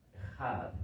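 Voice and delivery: Echad with a strong rasped J like Spanish jota; hard J sound. Shown as a demonstration. Pastor teaching Hebrew sounds the harsh J in Echad. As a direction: push air through the throat on Echad. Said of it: "Es una J fuerte".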